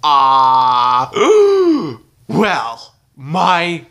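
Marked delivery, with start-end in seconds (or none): 1.2-1.9 loud high gasp of alarm; horrified gasp. Acted. Cowboy dentist looks into Junior's mouth: horrified gasp. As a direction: gasp at the worst tooth ever. Shocked at seeing the tooth.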